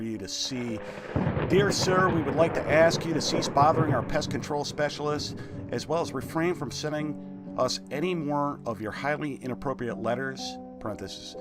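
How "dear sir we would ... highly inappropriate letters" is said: raised, stiff letter-reading voice; formal letter reading. Acted. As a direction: read it like a stuffy complaint letter. Reads letter in game aloud.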